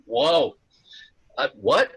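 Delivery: high, loud retold gasp of surprise with a laugh; jolted, caught off guard; startled whoa. Telling the interviewer how he reacted, startled and high.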